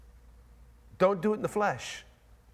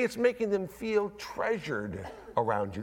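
normal talking voice

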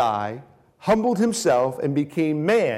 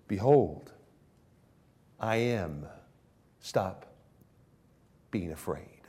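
whispered, much softer; hushed comfort; 7 semitones lower, 4 dB quieter, slower than usual. Retold or imitated. Christ whispering comfort to disciples afraid at sea. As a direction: breathe it as a soothing whisper.

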